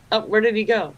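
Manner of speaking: ordinary talk, nothing marked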